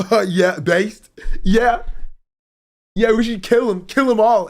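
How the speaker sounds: high, loud hammed-up gamer bravado; mock macho bravado. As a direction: bellow it like a cocky bro. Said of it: "he's like".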